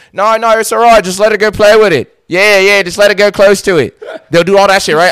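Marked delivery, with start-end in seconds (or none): 0.1-4.0 lightly voiced laid-back Aussie dad, higher and louder; breezy mock Aussie dad reassuring; hint of an Australian dad talking down a snake scare. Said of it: "the dad will damn this".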